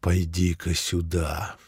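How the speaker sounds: put-on low soft gurgling old voice. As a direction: gurgle it out quietly. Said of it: "булькающим голосом сказал".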